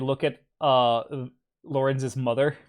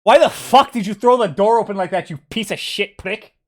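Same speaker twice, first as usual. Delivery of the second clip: angry yelling; 4 semitones higher, 8 dB louder than usual. Acted. Sister yelling at brother.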